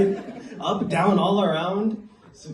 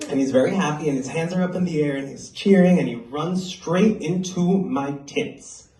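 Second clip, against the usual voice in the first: ordinary delivery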